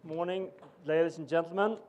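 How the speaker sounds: ordinary talk, nothing marked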